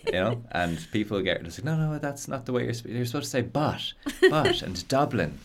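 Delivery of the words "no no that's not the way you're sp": ordinary talk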